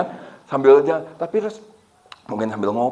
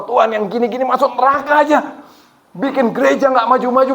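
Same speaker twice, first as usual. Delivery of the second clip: high, loud mock-furious plea to God; retold outburst, pitched up and loud; angry prayer; 12 semitones higher, 5 dB louder than usual. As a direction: act out an exasperated rant in prayer. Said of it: "Oh, marah sekali".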